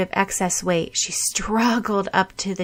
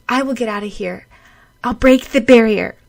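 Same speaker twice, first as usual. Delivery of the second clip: rising resolve; 4 semitones higher, 8 dB louder than usual. Acted.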